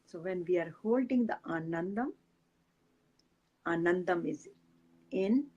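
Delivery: soft, unhurried meditation guide voice; guided meditation. Acted. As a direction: ease the listeners into calm breathing.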